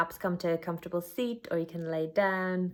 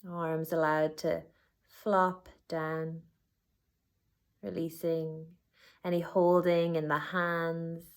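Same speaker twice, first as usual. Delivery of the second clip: unhurried body-scan guidance with long pauses; guided meditation. Acted. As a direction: guide them gently inward, letting each phrase settle.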